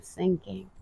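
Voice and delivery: usual speaking voice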